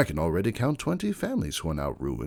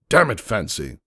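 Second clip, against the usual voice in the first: put-on louder, angry snap at his old rival; angry outburst; 7 dB louder than usual. Lord Hex angrily confronting Fancy Pants over his investment. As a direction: curse him out, louder. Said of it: "he said, pounding his hoof".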